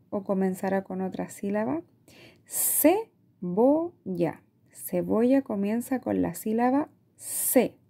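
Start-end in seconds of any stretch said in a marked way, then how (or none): 2.5-4.4 very slow, each syllable apart. Shown as a demonstration. Teacher segmenting a word for parents, very slow and syllabic. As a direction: stretch Cebolla into separate beats. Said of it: "vamos a ir segmentando".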